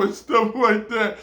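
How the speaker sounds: ordinary delivery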